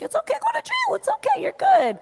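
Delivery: high breezy reassurance, imitating Trump; cheerfully brushes off going to jail; quoting Trump. Trump reassuring her about jail, high and breezy. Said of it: "I'm like".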